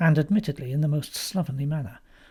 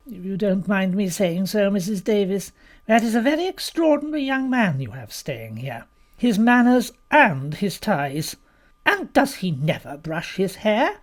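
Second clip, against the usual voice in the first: higher-pitched, haughty guest's complaint; disapproving Mrs Boyle; 5 semitones higher, 3 dB louder than usual. A narrator's reading. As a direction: draw out your disapproval of the young man.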